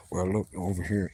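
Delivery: low, soft mumbled cowboy voice; soft mumbly Sam Elliott impression; Sam Elliott mumble. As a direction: mumble it low under your breath. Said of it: "he kinda talks under".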